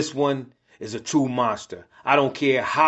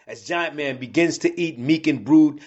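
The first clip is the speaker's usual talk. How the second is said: ordinary delivery, nothing marked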